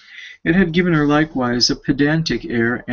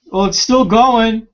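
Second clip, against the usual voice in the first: high, loud, irritated call about the heater; shouting across the house; 8 semitones higher, 6 dB louder than usual. Real, not acted. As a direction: bark it so Ricky hears you downstairs.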